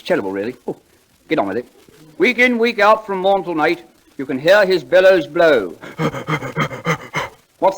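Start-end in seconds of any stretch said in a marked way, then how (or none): 2.2-5.8 slow, lofty recitation; poem recital. Acted. [name] reciting Longfellow to the audience, slowly and grandly. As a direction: declaim each line like a school prize reciter.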